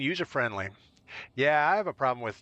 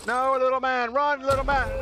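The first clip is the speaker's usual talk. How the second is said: much higher, louder squeaky cry; squeaky panic; 12 semitones higher, 5 dB louder than usual. Real, not acted. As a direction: yelp it high at the little guy. Panicked gaming moment, squeaky call.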